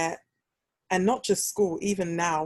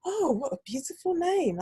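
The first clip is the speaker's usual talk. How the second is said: high, swooping mock admiration; gushing teacher; 11 semitones higher than usual. Cover teacher admiring the pupil's name, high gushing imitation. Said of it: "She was like".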